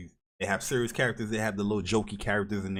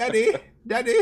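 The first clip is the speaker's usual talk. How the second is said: put-on kid voice; 7 dB louder than usual. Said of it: "My father".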